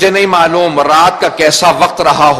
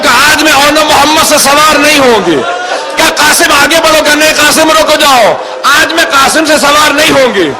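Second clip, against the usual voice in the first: raised, high-pitched lament narration; impassioned mourning sermon; 10 semitones higher, 3 dB louder than usual. A narrator's reading. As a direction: build the tragic recital at a high pitch.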